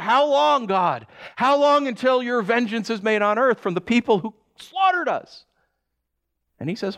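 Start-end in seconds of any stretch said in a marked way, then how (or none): 0.0-5.5 high, pleading cry. Retold or imitated. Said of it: "they're crying out".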